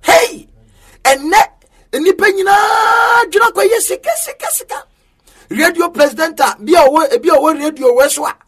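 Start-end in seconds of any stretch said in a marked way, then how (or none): 0.0-4.8 crying out. Real, not acted. Preacher decries greed for money.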